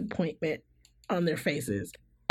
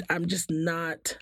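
usual speaking voice

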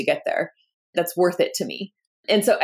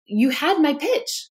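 mock-retold anger at the investors; a retold angry retort; indignant protest; 9 semitones higher than usual. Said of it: "I was so angry".

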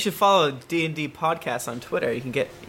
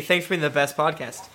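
usual speaking voice

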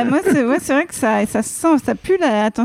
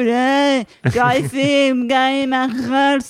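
put-on drunk party bragger; 2 semitones higher, 4 dB louder, much slower than usual. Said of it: "le mec, tu sais, bourré qui raconte des histoires en soirée".

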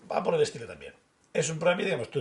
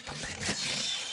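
susurro lento y muy pegado al micro; susurro ASMR; 5 dB quieter, much slower than usual. Pretend. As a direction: susurra despacio como en un vídeo ASMR. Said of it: "A S M R". (susurro ASMR, muy lento, a petición del amigo)

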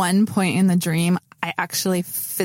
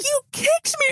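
high whiny husband. Retold or imitated. Imitating husband.

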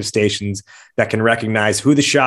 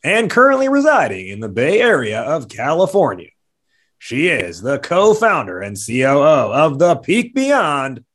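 boxing ring announcer; 3 semitones higher, 3 dB louder, slower than usual. Acted. Ring-style guest introduction.

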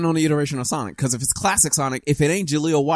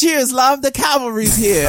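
put-on lightly done British accent; chirpy British voice; 10 semitones higher, 4 dB louder, slower than usual. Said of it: "I want him to be British".

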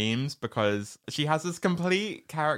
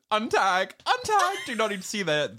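panicked; 10 semitones higher than usual. Retold or imitated. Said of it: "and you're like".

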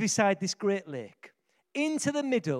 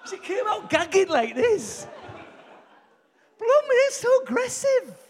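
excited; 12 semitones higher, 5 dB louder than usual. Real, not acted. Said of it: "I need to calm down".

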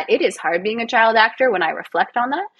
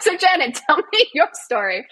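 high, playful mock interviewer; deflecting interviewer; 7 semitones higher than usual. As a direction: turn the question back in a raised, chirpy pitch. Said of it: "I deflect".

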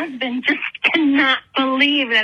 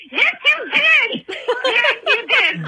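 insistent retort; 8 semitones higher, slower than usual. Retold or imitated. Insisting to husband in retold argument.

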